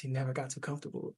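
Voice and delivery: quick, soft, sad drop. Shown as a demonstration. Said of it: "like, sadness in my voice".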